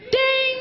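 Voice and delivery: high, loud ringing ding; ding of a bell. Pretend. Comedian scoring the husband a point, loud bell ding. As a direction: sound the scoreboard bell.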